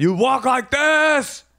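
very high, drawn-out mock shriek; hammed-up scream. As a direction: stretch it into a slow, comic scream. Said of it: "I screamed".

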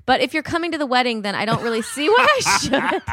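quick, high, mocking bride voice with a laugh; mock bride laughing through her text; entitled bride. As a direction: rattle it off like a spoiled bride. Reading the bride's text aloud.

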